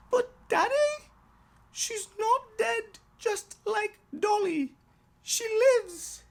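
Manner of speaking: put-on high, loud child's voice, earnest; insistent little girl. Daughter holding up her doll to daddy, high and insistent.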